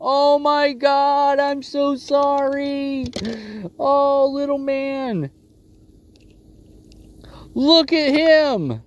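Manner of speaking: high, drawn-out tender cooing. Real, not acted. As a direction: coo at the little fish like a pet. Cooing over a baby shark.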